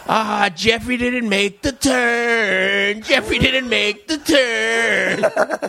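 high, slow sing-song teasing; taunting kid. Retold or imitated. Kids mock Jeffrey for missing the turn, sing-song taunt. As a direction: drag out the taunt like a playground chant.

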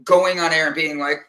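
normal talking voice

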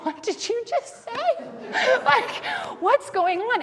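high, laughing "what did you just say"; laughing disbelief. Retold or imitated. Mother laughing at her son's words. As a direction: laugh through the question in disbelief. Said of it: "I said to Pax".

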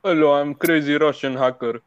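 lightly put-on Russian accent. Showing friends what Russian sounds like, in a Russian accent. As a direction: imitate a Russian speaker a little. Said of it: "This one is Russia".

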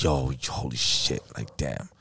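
deep, slow, pained mutter; low slow groan. Retold or imitated. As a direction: drag it out low in dread. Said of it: "I'm like".

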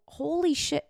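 a sudden high, startled exclamation; shocked holy shit. Retold or imitated. Laughing-crying at herself, sudden shock. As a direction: catch yourself in disbelief. Realizing crying over hair.

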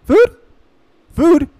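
rising yappy dog chant of food; hungry dog voice. Acted. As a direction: beg like an eager puppy.

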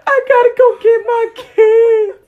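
exaggerated sobby whine, stretched and loud; mock crying. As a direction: imitate a drunk friend bawling. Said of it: "She fucking cried, like, eight times".

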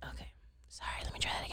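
soft unvoiced whisper. Real, not acted. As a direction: whisper the apology aside. Whispered apology after misreading.

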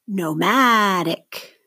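"Nomadic" very slowly, MAD stretched; stress on second syllable. Shown as a demonstration. Teacher models for seventh graders to mark, slow stressed MAD in "Nomadic". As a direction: say "Nomadic" as no-MAD-ic, rising onto MAD. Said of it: "circle the stress syllable".

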